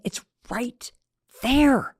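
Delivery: usual speaking voice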